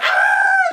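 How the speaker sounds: very high, loud mock scream; Leo screaming impression. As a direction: shriek like an overacting movie character. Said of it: "he starts screaming".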